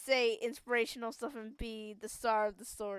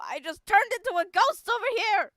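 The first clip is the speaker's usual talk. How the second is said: ghostly panic; 9 semitones higher, 10 dB louder than usual. Acted. Player voicing ghost student panic.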